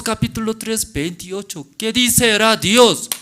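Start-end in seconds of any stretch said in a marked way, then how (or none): none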